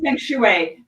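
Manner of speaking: feng shui in a slow French accent, fang shway. Shown as a demonstration. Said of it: "my French accent".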